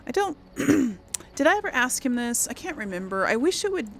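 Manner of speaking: normal talking voice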